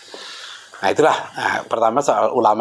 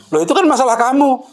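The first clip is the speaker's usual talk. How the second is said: imitating an incredulous retort, high-pitched; retold retort; 13 semitones higher than usual. Mentor turns the student's question back on him, retold retort. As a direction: toss it back in a much higher voice.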